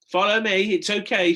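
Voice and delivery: high, loud reassuring call. A narrator's reading. Stella to Tom below the ladder, calling up. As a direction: call him down, it's safe. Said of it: "she called".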